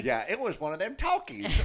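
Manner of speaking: high, put-on old-timer voice; mock old-timer. Teasing back about being fifty, in an old-timer voice. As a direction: play the ancient grandpa remembering talkies. Joking he is ancient.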